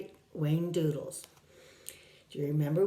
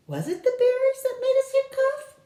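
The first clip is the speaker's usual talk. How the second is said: put-on very high, louder child voice; child's question; 6 dB louder than usual. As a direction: ask like a small anxious boy. Child Tom asks.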